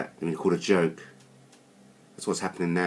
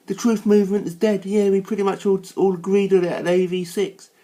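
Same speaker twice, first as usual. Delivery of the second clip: high, louder mocking whine; mocking Sheridan; 7 semitones higher, 4 dB louder than usual. Mocking rival Thomas Sheridan in a high whine. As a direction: send up a rival by whining his words back. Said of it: "Yeah, Thomas Sheridan go".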